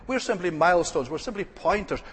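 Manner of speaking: ordinary talk, nothing marked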